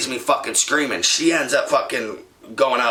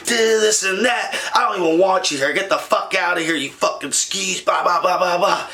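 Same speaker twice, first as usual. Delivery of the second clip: higher-pitched angry rant; going off; 5 semitones higher than usual. Retold or imitated. Throwing his cheating girlfriend out in an angry rant. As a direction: keep ranting at her, voice up. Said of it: "I am going off".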